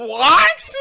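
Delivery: loud high laughing what; surprised. Real, not acted. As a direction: shriek of disbelief. Shocked reaction to claim.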